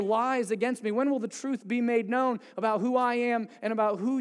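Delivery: desperate prayer, lifted voice; desperate cry for help. Retold or imitated. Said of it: "Do you hear the desperation?".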